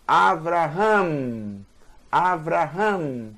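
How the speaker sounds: Avraham slowly, with a V. Shown as a demonstration. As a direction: spell out Avraham slowly, V not B. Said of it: "Não é porque aparece essa letra que vai ter som de B".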